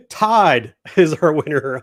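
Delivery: slow, drawn-out winner announcement; hyped giveaway announcement. Acted. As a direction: stretch it out like a game show host.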